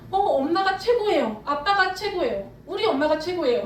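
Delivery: lightly acted child's adoring praise; child praising parents. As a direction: gush like a kid about your parents. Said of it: "우리로 치면 자식들이 부모한테".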